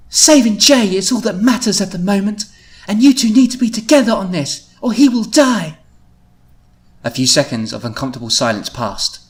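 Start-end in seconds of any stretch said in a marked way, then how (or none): 0.1-5.8 high, loud, insistent warning; very firm. A narrator's reading. Louise urging the two to save Jay, very firm. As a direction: insist on it in a raised voice. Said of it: "She was very firm as she spoke".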